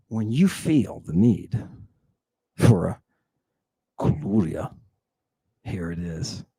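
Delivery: mock sponsor read, sinking to a soft low murmur; mock commercial. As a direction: drop down low and soft, drawing out the sales pitch. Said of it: "That was a little commercial".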